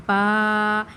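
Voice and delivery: با with a long a held one count; Arabic ba. Shown as a demonstration. Teacher models the letter for the students. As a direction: say با full, lips closing. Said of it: "اس کو پڑھنا ہے با".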